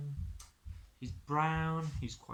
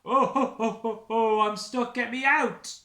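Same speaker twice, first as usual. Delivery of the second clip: loud, high hearty ho ho then a shout; chuckle turning to a shout; 8 semitones higher, 11 dB louder than usual. Acted. Santa stuck in the chimney, laughing then shouting. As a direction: laugh then yell for help. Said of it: "starts as a chuckle and turns to a shout".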